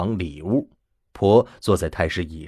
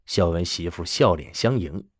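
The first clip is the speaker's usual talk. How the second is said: plain reading voice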